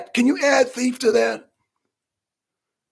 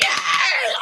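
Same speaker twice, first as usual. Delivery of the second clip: mock crowd cheer. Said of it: "Everybody's like".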